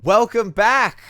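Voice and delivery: loud, high show opener; excited welcome. Acted. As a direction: greet the audience with big energy. Podcast intro greeting.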